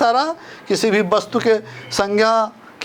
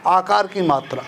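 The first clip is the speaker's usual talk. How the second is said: ordinary talk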